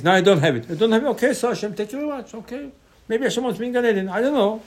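high-pitched, easygoing resignation; acted humble acceptance. Teacher voices a humble person thanking Hashem, lightly resigned. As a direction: shrug it off in a lifted voice.

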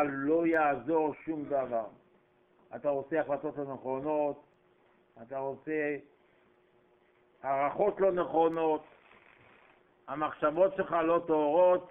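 ordinary talk, nothing marked

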